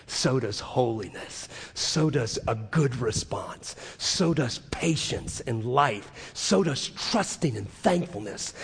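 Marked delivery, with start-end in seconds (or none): none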